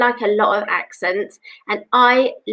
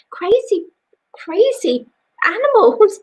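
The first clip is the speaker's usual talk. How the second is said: rising playful exclamation; playful squeal; 9 semitones higher than usual. Real, not acted. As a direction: squeal it with delight. Playful rising about cats.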